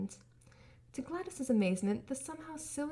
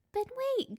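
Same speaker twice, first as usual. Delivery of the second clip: much higher, sudden realization; high robot voice; 14 semitones higher than usual. A narrator's reading. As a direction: perk up like a child. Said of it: "Gur said".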